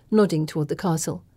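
usual speaking voice

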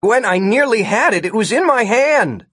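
high, insistent arguing; exasperated outburst; frustrated protest. A narrator's reading. Martin argues with Gwen.

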